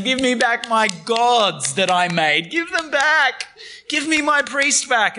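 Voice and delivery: hammed-up wail of a robbed man; mock desperate pleading. Micah chasing the thieves.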